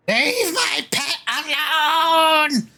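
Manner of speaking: high, drawn-out goblin screech; screaming goblin. Acted. Goblin runs in to defend pet, screeching high. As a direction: screech it slowly, stretching out the last word. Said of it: "he's just screaming at".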